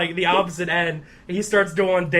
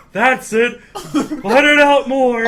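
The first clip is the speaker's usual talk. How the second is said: louder, slower, higher urging; 6 semitones higher, 7 dB louder, slower than usual. Retold or imitated. Narrator egging on the meat guy, louder and slow. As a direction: egg him on, laughing. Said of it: "I'm like".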